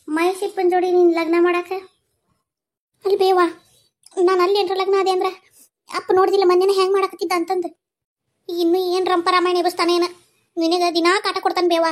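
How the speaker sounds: high, loud and urgent; alarmed protest. Acted. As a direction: plead in rising alarm for the mother's sake. Alarmed protest over secret wedding plan.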